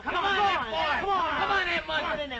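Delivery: high, excited yelling over the crowd; racetrack cheering. Pretend. Boys cheering an imagined horse race, excited yelling. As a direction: urge the rider on, pitch way up.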